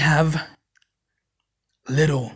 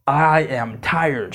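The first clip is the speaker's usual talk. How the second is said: weary; 6 semitones lower, 4 dB quieter, much slower than usual. Retold or imitated. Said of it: "calling her and saying".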